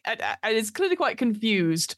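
ordinary talk, nothing marked

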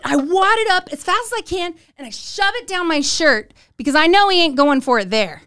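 ordinary delivery

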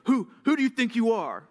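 loud, high indignant snap back; mad retort. Acted. Person snapped at, angrily snapping back. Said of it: "get mad and say".

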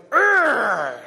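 loud guttural war growl; intimidating growl. Retold or imitated. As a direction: growl to scare the other team. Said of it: "they start going".

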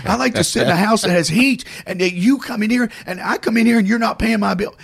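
high, worked-up rant; retold indignant yelling. Woman ranting that her bill isn't paid, indignant yelling. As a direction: keep her heated complaint rolling. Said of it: "stop yelling at me".

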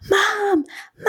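high, loud excited call; retold screaming. Daughter calling her mom after getting approved, retold scream. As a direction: cry out high for mom, bursting with news. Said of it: "I was screaming".

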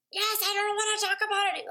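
put-on irritated parent cutting a child off; mad parent snapping. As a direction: snap it like a fed-up parent. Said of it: "we're really mad".

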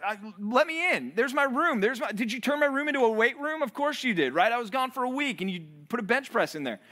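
arrogant entitled son. Retold or imitated. Son demanding his old room back, mock indignant. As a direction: play the spoiled son barging home. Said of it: "presumptuously or arrogantly".

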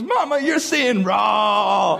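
high, drawn-out child imitation; teasing daughter. Daughter teasing mama from the back, high mock call. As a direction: mimic a laughing kid calling out her mama. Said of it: "Novella laughs. And she goes".

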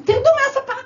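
high, loud order off the sofa; retold shout. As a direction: bark it at the kids. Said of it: "פעם הייתי מדברת אליהם ככה".

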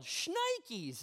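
high startled mock yell. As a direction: yelp it like the deer just appeared. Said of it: "And I yell".

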